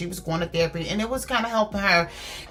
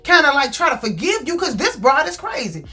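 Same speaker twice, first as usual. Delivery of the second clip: high-pitched retold outburst, louder on the key words; exasperated rant; 8 semitones higher, 4 dB louder than usual. Woman fed up with her man over a keyed car, exasperated rant.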